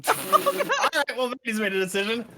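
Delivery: laughing. Real, not acted. Laughing at the creature fleeing.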